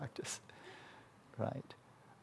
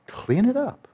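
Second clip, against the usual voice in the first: higher, rising urging; high insistent order; 12 semitones higher, slower than usual. Shown as a demonstration. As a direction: push it up higher as a plea. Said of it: "So clean it up".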